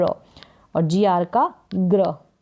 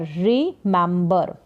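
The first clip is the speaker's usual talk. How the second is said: Remember drawn out slowly in parts, "ri-mem-bar"; slow syllable breakdown; 2 semitones lower, much slower than usual. Shown as a demonstration. English teacher modelling a new word, slow syllable-by-syllable remember.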